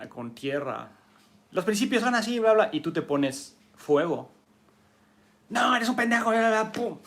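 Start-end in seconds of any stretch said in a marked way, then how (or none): 1.5-2.7 much higher and louder, digging in; stubborn insistence. Acted. Rigid person pushing back on the student, loud insistence. 5.5-7.0 much higher and louder, hammed-up snapping; mock angry insult. Teacher voicing a fiery person insulting the rigid one, mock angry snap.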